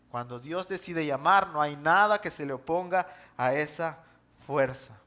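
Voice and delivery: ordinary talk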